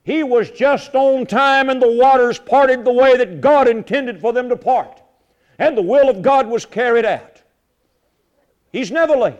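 loud, rising preacher's proclamation; preaching at full force. Acted. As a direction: proclaim it to the congregation.

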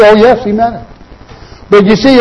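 ordinary talk

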